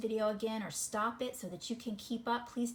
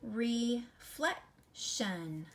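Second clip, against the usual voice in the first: "Reflection" drawn out very slowly; slow stretched word; much slower than usual. Shown as a demonstration. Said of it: "This is".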